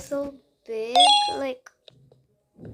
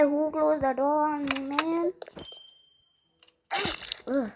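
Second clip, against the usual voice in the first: ordinary delivery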